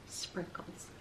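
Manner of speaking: soft whispered chapter name; whispered title. Acted. Reader teasing the children with the chapter title, whispered. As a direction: breathe the word like a secret.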